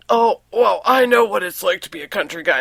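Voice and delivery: a hint of a boastful husband's voice; husband imitation. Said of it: "guy who lives in my house".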